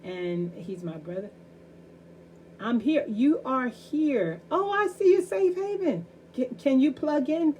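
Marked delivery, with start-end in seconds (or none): 2.6-7.5 calling out. Real, not acted. Host calling to pastor joining livestream.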